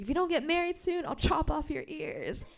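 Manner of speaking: high, fast mock-threatening granny voice; imitating Popo. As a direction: play the scolding grandma, hammed up. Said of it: "she's imitating my Popo".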